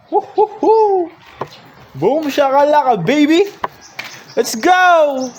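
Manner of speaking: high, loud celebration cheer; hype shout. Real, not acted. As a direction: cheer it out big.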